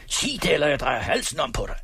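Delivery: high, fast threat in a rage; raging, urgent outburst; furious. A narrator's reading. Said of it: "ganske rasende".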